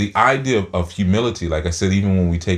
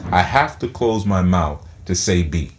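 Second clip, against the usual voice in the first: ordinary delivery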